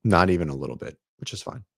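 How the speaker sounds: normal talking voice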